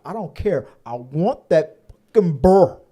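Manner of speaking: imitating a stubborn Southern woman demanding her bear; half-shouted Southern accent impression; Southern accent quote. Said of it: "she has a very deep Southern accent".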